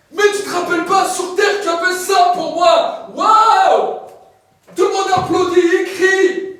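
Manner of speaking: loud, high booming proclamation; preaching at full force. Acted. Preacher describing heaven's throne to the congregation, loud. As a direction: shout the glory of heaven to the crowd.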